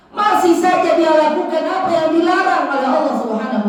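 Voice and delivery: high, forceful sermon rebuke; impassioned preaching. Acted.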